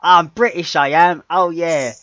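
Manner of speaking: high, loud hammed-up English accent; mock English accent. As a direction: play a cartoon Brit. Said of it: "He tried to do the English accent".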